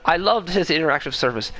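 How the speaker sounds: ordinary talk, nothing marked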